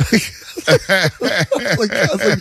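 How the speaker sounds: high laughing words, slowed. Real, not acted. Laughing while retelling.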